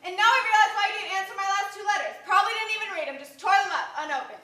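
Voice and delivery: loud, indignant reproach; hurt accusation. Acted. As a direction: let the hurt pour out at full volume. Acted quarrel, she reproaches him.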